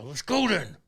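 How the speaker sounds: loud mock challenge; fighting challenge. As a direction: shout it like you're squaring up. Acting out retold fight.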